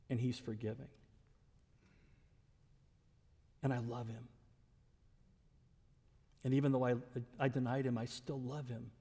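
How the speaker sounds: low, soft, heartfelt confession; tender realisation. Acted. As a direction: drop right down, let it out with long pauses. Peter's tender realisation, voiced softly.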